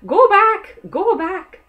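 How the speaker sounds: high, loud squawking bird cry; grouse crying out. Acted. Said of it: "made it cry out".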